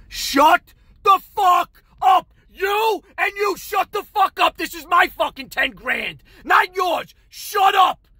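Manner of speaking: very high, loud screamed order; furious shouting. Retold or imitated. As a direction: scream two arguing men into silence. Yells over two screaming men.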